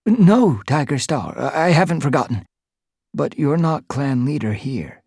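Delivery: high, quick stammering reply; a cowed cat who still dares to object; flustered protest. A narrator's reading. Boulder cowering yet objecting.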